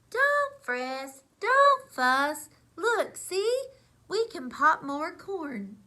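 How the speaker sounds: high, loud little mouse voice; squeaky mouse. Acted. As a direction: squeak it out to comfort. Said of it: "But the mouse squeaks".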